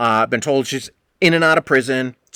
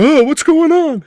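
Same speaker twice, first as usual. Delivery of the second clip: much higher, louder, slow clueless voice; imitating Steve; 8 dB louder, slower than usual. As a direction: play the friend who wanders in clueless. Voicing Steve walking in.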